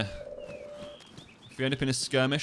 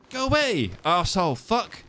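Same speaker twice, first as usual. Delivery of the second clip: mock yell; 6 semitones higher than usual. Playful mock insult.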